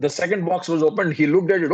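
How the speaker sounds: ordinary talk, nothing marked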